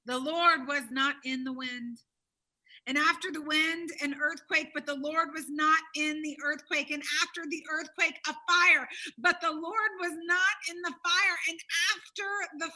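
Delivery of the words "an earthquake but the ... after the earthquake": ordinary talk, nothing marked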